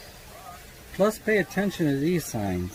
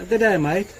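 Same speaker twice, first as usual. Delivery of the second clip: put-on Australian accent; 4 semitones higher, 4 dB louder than usual. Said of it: "From Eastern Australia".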